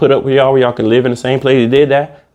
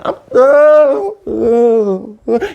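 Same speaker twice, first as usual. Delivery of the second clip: high, hammed-up wailing oh; mock heartbroken wail; 6 semitones higher, much slower than usual. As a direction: wail like an overdone breakup ballad. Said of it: "I'm supposed to be crying".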